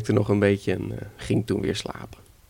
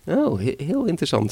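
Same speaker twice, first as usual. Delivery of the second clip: high-pitched, hesitant grandmother; feigned interest; 6 semitones higher than usual. Acted. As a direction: pretend it is merely interesting. (gespeeld onschuldige belangstelling)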